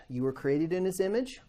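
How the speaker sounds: usual speaking voice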